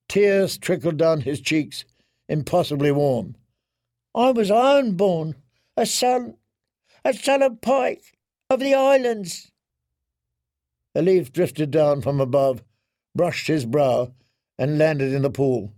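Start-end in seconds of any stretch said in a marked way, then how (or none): 4.1-9.5 high, tear-choked voice; clinging to who he once was; tearful Theon. Acted. Theon weeping, remembering his home.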